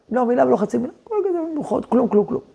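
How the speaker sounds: hushed, laid-back imitation of a permissive dad; lightly voiced hands-off father, softly waving it all away; easygoing father. Second neighbour asking nothing of his children, lightly voiced as easygoing. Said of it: "והוא היה הכל כזה ליברל".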